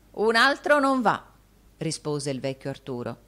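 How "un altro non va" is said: higher, louder refusal; raised old man's voice. A narrator's reading. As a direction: refuse loudly so the deaf servant hears. Arturo shouts to deaf servant.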